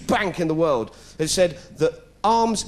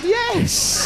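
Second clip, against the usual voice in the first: sheepish yes; 3 dB louder than usual. Acted. Press officer admits, laughter.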